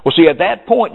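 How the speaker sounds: ordinary talk, nothing marked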